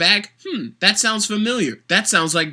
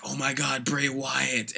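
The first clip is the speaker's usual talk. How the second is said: lightly gushing fan voice; a hint of starstruck hype; mock fan excitement; 4 semitones lower, 8 dB quieter than usual. Vlogger imitates fans first hyped about Bray Wyatt, mild mock excitement. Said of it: "Everyone was like".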